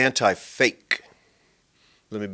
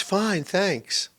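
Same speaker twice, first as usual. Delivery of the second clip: mock automatic reply; 9 semitones higher than usual. Mimics preprogrammed response.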